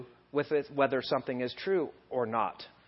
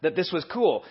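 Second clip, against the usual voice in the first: ordinary talk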